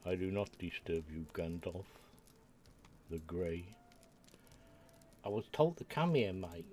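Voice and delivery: a hint of a Cockney bloke; Cockney accent. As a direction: answer the wizard like a Cockney. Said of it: "Got this, uh, Cockney accent".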